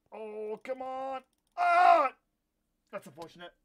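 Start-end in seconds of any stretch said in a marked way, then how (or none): 1.6-2.1 a sharp, high cry of frustration; pained shout. Real, not acted. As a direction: yelp as you fall. Falling in the game.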